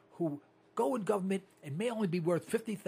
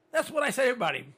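ordinary delivery

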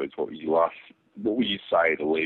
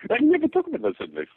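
high-pitched mocking imitation, close to laughter; Woody Allen. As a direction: echo the host in a high comic voice. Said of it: "You sound Woody Al-, Woody Allen, man".

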